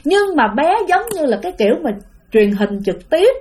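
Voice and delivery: ordinary talk, nothing marked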